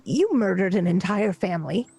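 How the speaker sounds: ordinary delivery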